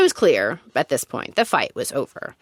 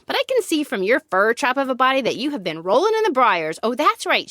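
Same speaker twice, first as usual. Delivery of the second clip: higher-pitched, indignant taunt; mock snarl; 6 semitones higher than usual. A narrator's reading. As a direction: taunt them in a raised pitch, without shouting. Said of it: "She snarls and shouts".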